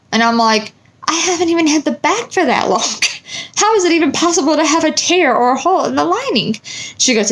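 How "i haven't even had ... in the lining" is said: higher, laughing incredulous protest; exasperated disbelief. Retold or imitated. Answering the stranger about her new bag, high and incredulous.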